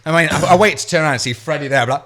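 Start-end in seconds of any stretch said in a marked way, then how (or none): none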